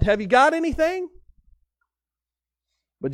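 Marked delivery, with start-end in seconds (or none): 0.0-1.1 high, mild call across the water; calling out to shore. Retold or imitated. Pastor calling out to the kids down by the pond, mildly retold. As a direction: call out to the kids fishing far off. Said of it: "you yell out".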